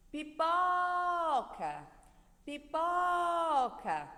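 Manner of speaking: Pipoca with a long, loud, high PO; pi-POOO-ca, very slow and loud on PO; stress on PO. Shown as a demonstration. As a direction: call out pipoca, holding the middle syllable. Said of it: "o pó é a sílaba mais forte".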